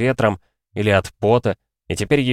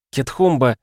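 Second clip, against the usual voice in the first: put-on high exclaimed name, mildly drunk mother; exclaiming; 6 semitones higher than usual. A narrator's reading. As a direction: cry out the name in a higher voice. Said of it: "его мать воскликнула". (удивлённый возглас матери)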